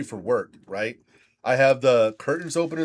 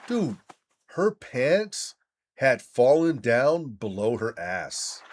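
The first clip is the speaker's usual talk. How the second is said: ordinary talk, nothing marked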